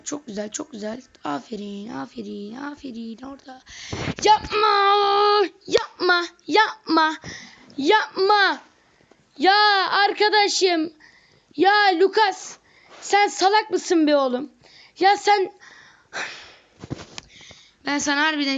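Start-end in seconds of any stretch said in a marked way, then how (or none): 0.0-3.6 quiet praise. Real, not acted. Fading praise. 4.2-15.5 high, loud, slowed yells of frustration; angry gamer rant; yelling at the game. Real, not acted. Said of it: "Ben niye şu anda çok bağırarak konuşuyorum".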